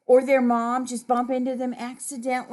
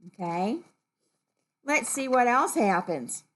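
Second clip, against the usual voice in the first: ordinary delivery, nothing marked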